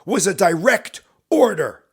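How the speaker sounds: loud, slow, word-by-word command; glaring shout. Acted. Captain glaring at the hesitant corporal, shouting slowly. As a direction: hammer each word of the order. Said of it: "he's glaring at you and he shouts".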